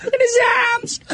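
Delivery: put-on high, shouted old lady voice. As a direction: squawk it like the outraged coworker. Jenny voiced again.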